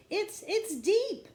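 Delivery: high, loud, slow, panicked gasp. A narrator's reading. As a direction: gasp it out, struggling in the water. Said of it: "she gasped".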